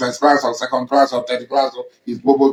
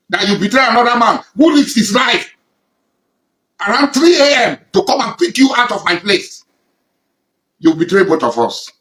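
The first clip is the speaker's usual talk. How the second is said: building from loud to higher and louder; indignant voice rising higher and higher; rising anger; 5 semitones higher, 3 dB louder than usual. Real, not acted. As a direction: let the outrage climb with each clause. Angry accusation of a betrayer.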